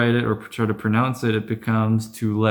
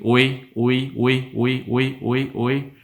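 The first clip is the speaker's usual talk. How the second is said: said quickly; 3 semitones higher than usual. Shown as a demonstration. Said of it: "try to say this quickly".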